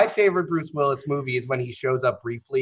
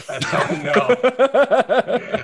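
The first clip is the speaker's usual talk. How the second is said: laughing; 10 semitones higher, slower than usual. Real, not acted. Laughs over own joke.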